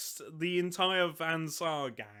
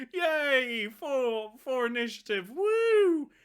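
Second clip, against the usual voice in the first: high, loud whooping cheer; 7 semitones higher, 5 dB louder, slower than usual. Real, not acted. Host celebrating better initiative, high loud cheering.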